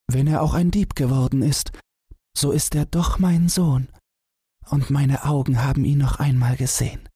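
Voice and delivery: high, moved mother's voice; tearful mother. Acted. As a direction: lift the voice high as a mother moved by her son. Mother after weeping for joy.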